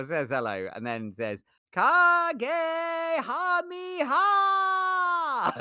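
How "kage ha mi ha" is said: very high, drawn-out power-up shout; anime attack cry. Acted. Streamer acting out a Dragon Ball chat message, long shout. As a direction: stretch each syllable into a huge battle yell.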